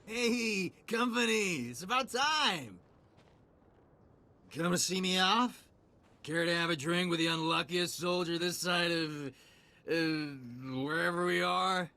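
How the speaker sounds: put-on high, merry drunken hail. Lone soldier inviting a stranger for a drink, drunk. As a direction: greet them like a tipsy soldier, high and loose. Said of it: "You smell like you've had a few too many drinks".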